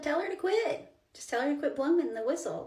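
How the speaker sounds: high-pitched, insistent telling-off; exasperated instruction. Retold or imitated. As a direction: push it high, impatient with mom. Daughter impatiently instructs mom, retold.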